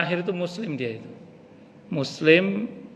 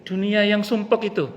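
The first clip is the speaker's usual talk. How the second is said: higher, scoffing at the cramped world; dying man scoffing; 6 semitones higher, 4 dB louder than usual. Acted. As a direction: brush off the world, pitched up. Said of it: "Kata yang mau mati".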